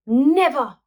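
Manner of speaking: loud, high stubborn cry; defiant never. A narrator's reading. Boy defying all the dinosaurs. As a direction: refuse with all you've got. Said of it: "replied Spike".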